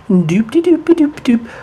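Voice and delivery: high, bouncy doop-de-doop; carefree nonsense singsong while strolling; singsong innocent humming. Acted. Boy acts out innocently walking past the showers, high singsong.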